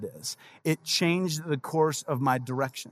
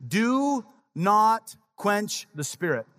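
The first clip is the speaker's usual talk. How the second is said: each word drawn out slowly; slow solemn reading; 3 semitones higher, much slower than usual. Shown as a demonstration. Pastor slowly reading scripture to the congregation, solemn and drawn out. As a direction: read it slow and weighty, like scripture. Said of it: "Notice what he says".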